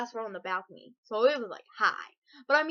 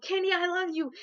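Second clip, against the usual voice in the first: high excited yell; 7 semitones higher than usual. Retold or imitated. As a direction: shout your love across the room. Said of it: "I yelled".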